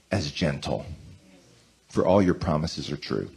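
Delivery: prayer mode. Acted. Pastor leading church in prayer, reverent and gentle. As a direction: pray it aloud, gently, for all.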